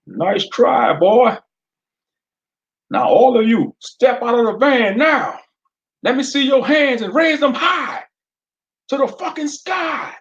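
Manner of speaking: a gun-waving sheriff yelling at the van; demanding sheriff. Acted. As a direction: sheriff shouting at the men to raise their hands. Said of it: "Officer McClure demanded".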